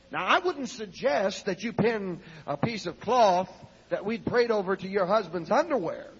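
usual speaking voice

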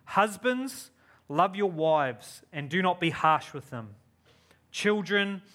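ordinary talk

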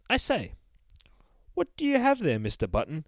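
high, airy, posh-sounding storybook narrator; fancy narrator voice. Acted. Narrator politely questions the bear, high fancy voice. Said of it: "Mister Fancy Voice".

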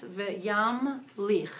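slow, each syllable separate. Shown as a demonstration. Teacher sounds out syllables for a struggling student. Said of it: "syllable by syllable".

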